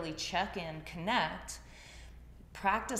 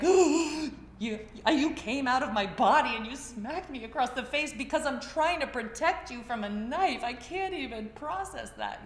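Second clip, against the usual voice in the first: loud, much higher disbelieving rant; exasperated outrage; 6 semitones higher, 7 dB louder than usual. Retold or imitated. Mother scolding the child who smacked her, loud indignant disbelief. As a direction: let the outrage burst out loud and high.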